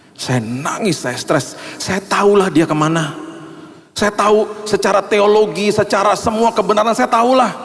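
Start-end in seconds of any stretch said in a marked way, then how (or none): 1.8-7.5 high, urgent preaching voice; impassioned preaching. Acted. Preacher tells the church he still grieves, impassioned. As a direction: press the point home with fervour.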